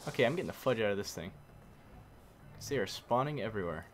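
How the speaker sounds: normal talking voice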